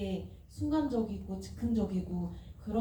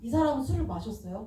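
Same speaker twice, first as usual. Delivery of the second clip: ordinary talk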